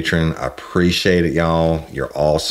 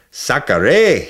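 high, loud Cajun curse; Cajun exclamation; 9 semitones higher, 6 dB louder than usual. Acted. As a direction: yelp it in Cajun surprise. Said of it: "he exclaimed".

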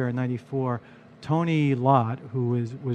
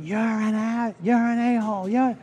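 imitating a drunk customer's high yell; hammed-up drunken screaming, much higher; 8 semitones higher than usual. Drunk customer screaming insults at the new bartender. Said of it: "he starts screaming".

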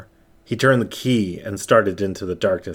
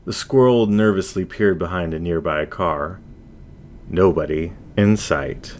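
plain reading voice

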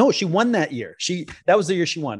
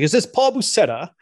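higher-pitched imitation of an English accent; 7 semitones higher, 4 dB louder than usual. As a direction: lightly mimic an English boss on the phone. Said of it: "he has an English accent".

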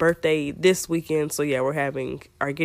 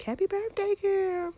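much higher sweet singsong; singsong birthday wish; 4 dB quieter than usual. Real, not acted. Wishing baby girl happy birthday.

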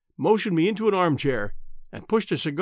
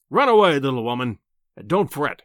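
lightly voiced patronising professor, loud start dropping low; pompous professor; 3 semitones lower, 4 dB louder than usual. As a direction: dismiss her indulgently.

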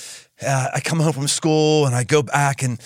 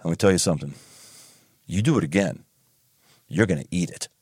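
stern dad voice; 9 semitones lower than usual. Retold or imitated. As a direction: lay down the law like dad. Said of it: "he comes up and he says".

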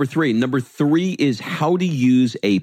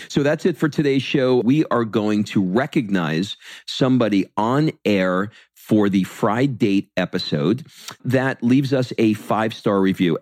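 ordinary talk

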